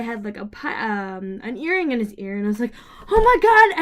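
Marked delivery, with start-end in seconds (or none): 3.1-3.8 loud, high retold gasp of discovery; excited exclamation. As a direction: burst out in over-excited amazement. Acts out own excited greeting.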